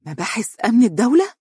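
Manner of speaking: high, rising, horrified question; alarmed rising disbelief; terrified echo. Acted. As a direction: repeat it back in terror. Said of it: "اتسعت عيناها رعبا وهي تردد خلفه".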